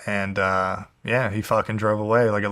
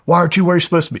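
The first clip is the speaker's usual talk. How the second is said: higher, mildly heated imitation of a scolding; retold mad; 6 semitones higher, 3 dB louder than usual. Husband pulls up and orders her back to work, mock mad. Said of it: "the only thing he's mad about".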